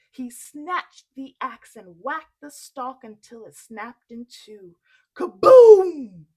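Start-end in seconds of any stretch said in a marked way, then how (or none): 5.1-6.3 loud crash. Acted. Crash sound effect.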